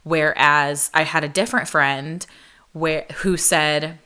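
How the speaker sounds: ordinary talk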